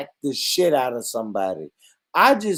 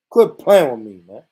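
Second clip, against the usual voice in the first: louder, punched out to chat; loud repeat; 4 semitones lower, 7 dB louder than usual. Real, not acted. Streamer repeats it louder to chat after muttering. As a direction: call it out this time.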